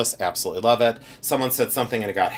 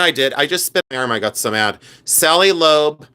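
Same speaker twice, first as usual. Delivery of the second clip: ordinary delivery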